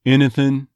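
"Anything" starting like "in", ending -thin without g; Texas "Anything". Shown as a demonstration. Coach models the accent for actors, "Anything" as innathin. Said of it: "it'll become more like an I".

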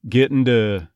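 "Getting to" as "GIT-n-duh"; "Getting to" run together, ending in "duh". Shown as a demonstration. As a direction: soften the T of "to" to a D in "Getting to". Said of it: "more of a D sound at the beginning".

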